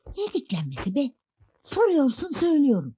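high, defiant comeback; indignant retort. Acted. Wife answers back to husband in a quarrel, indignant. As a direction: throw it back at him, pitch jumping up.

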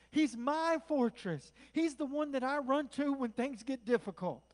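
loud, impassioned preacher, pitch lifted; full-force preaching. Real, not acted. Preacher urging church to run to Jesus, full-force preaching. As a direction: drive each phrase out to the whole congregation.

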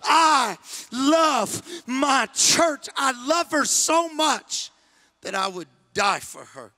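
Jesus crying out his love for the church; Jesus shouting. Acted. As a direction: cry out with arms stretched wide. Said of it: "Jesus that shouted".

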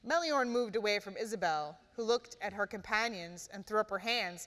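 usual speaking voice

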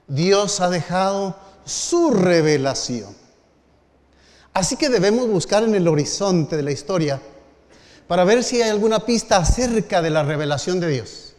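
put-on preacher addressing the young brethren with fervor; preaching. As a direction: build the sermon to its appeal. Preacher builds to appeal.

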